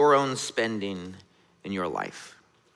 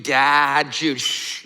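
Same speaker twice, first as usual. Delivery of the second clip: mild retold scream; 5 dB louder, much slower than usual. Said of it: "she's screaming".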